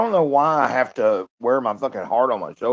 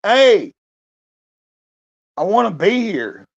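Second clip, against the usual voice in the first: loud, much higher chipper wake-up voice; bright, loud hammed-up good morning; cheerful morning self; 11 semitones higher, 8 dB louder, slower than usual. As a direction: play the happy guy he wishes he were. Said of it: "wake up and go".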